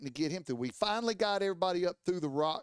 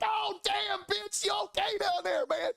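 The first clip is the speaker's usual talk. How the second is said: very high-pitched frantic holler; over-the-top excited yell; excitable shout. Retold or imitated. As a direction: re-enact yelling down to a fallen friend. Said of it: "I'm an excitable motherfucker".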